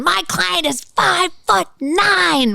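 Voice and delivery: mock-theatrical defense attorney; theatrical lawyer, drawn out. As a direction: stretch it out like a grandstanding lawyer. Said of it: "he's banging on the counsel table".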